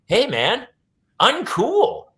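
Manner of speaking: high-pitched mock reproach; disapproving objection. Offended people scolding a comic over an accent, disapproving. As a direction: call out a pal, pitched well up.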